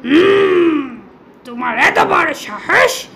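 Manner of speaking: a lightly growled angry lion voice; irritated lion scolding the mouse; roaring lion. Acted. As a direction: play the lion, mildly menacing. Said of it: "সিংহ হুংকার ছেড়ে বলতে লাগলো".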